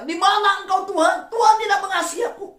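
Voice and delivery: high, loud accusing cry at God; mock accusing cry. Preacher mimics a believer crying out at God, high and loud. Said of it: "Jangan berkata".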